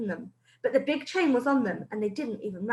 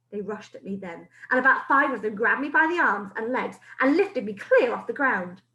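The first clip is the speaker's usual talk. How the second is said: usual speaking voice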